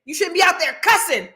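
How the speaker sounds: mock a preachy father scolding; imitating her dad. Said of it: "he was like".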